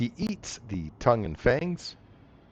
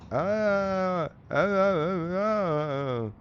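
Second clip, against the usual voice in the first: high, strained toothless hiss; 7 semitones higher, much slower than usual. Acted. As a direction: gasp it out like a tongueless creature. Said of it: "he calls out in a hissing voice".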